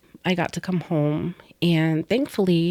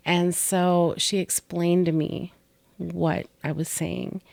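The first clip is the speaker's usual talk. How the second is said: ordinary delivery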